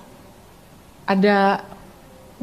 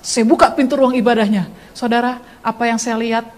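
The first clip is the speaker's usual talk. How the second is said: usual speaking voice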